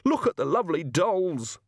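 high-pitched distraught outburst; tearful anger. Acted. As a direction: wail it in angry despair. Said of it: "tears of anger streaming down his face".

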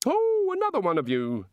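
high, delighted god voice; cheerily surprised, pitch leaping up; bright. A narrator's reading. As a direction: greet a newcomer with bright, high-pitched interest. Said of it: "said the god brightly".

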